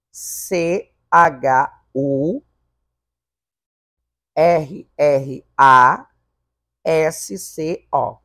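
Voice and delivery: slow, deliberate letter by letter; spelled out slowly. Shown as a demonstration. Teacher spelling churrasco to students, very slowly. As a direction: spell it out for the class, one letter at a time. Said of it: "ó".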